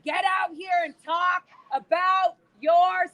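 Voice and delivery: high, drawn-out shouting; real protest shout. Real, not acted. Protester urging members to come out and talk, slow shouting. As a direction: shout slowly so it carries.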